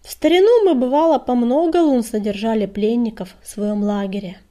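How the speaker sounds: put-on creaky old voice. A narrator's reading. Old she-cat recalls old times, lightly creaky. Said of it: "проскрипела она".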